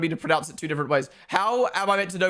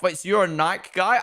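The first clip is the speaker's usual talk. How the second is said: ordinary delivery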